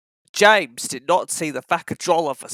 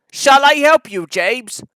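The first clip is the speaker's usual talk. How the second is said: higher, louder call; 7 semitones higher, 7 dB louder than usual. Acted. Edward offering James help, higher and louder. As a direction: call across the line. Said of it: "called Edward".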